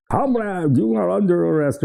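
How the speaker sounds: put-on high-pitched Russian-accent soldier over a bullhorn; mock Russian accent. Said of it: "with a Russian accent on jeeps and bullhorns".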